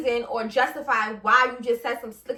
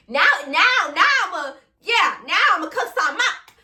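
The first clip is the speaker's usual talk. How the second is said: mock hype; 6 semitones higher, 7 dB louder than usual. Gleeful threat of starting ruckus.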